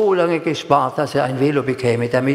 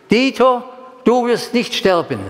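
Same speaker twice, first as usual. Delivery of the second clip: high, louder call of a voice in the night; 9 semitones higher, 3 dB louder than usual. Retold or imitated. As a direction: call it out clearly like a voice from above. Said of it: "die ganz deutlich rief". (laut und hoch gerufen: „Dieter, du wirst nicht sterben“)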